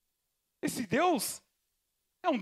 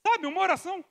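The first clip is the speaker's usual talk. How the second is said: ordinary talk, nothing marked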